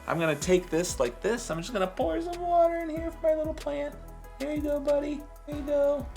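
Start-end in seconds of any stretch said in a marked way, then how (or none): 1.5-6.1 much higher coo to a little plant; baby talk to plant. Acted. Talks to plant.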